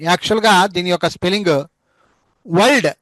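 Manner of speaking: ordinary talk, nothing marked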